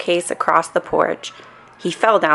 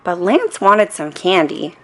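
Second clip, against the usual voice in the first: ordinary delivery, nothing marked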